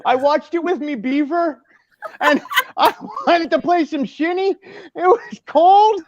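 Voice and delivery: high, hammed-up Canadian accent; bad mock Canadian accent. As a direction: do a terrible Canadian accent through giggles. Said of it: "worst Americanized version of a Canadian accent".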